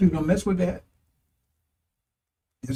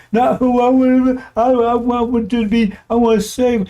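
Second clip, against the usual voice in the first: high, drawn-out mock protest; mock-pleading objector; 5 semitones higher than usual. Preacher mimicking someone who wants to save the enemy, mock-pleading. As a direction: whine it slowly, higher, as a mocked objector. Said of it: "It's two different attitudes there".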